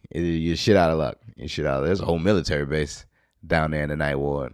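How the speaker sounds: ordinary talk, nothing marked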